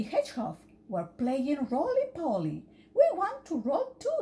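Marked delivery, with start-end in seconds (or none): 2.9-4.2 eager. Acted. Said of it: "said Polly".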